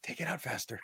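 very soft whispered plea; dying whisper. Retold or imitated. Superman near death urging Lana to hurry, weak whisper. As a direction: imitate a dying man urging someone on. Voicing hero on verge of death.

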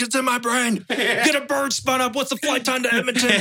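high urgent demand; frantic call for help, voice way up; hammed-up frantic plea; mock panic.

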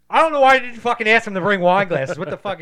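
loud, high, exasperated yell; imitating a friend snapping at his girlfriend about wine glasses; retold angry outburst. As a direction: blow up at her over the wine glasses. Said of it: "And you're like".

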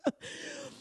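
short very high giggle; a squeaky burst of laughter; high squeal laugh. Real, not acted. Laughing at reunion joke.

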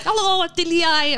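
high, put-on Irish hello; put-on Irish greeting. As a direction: play up the Irish accent. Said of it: "And then I'm like".

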